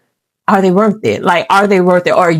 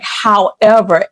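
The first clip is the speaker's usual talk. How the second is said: ordinary talk, nothing marked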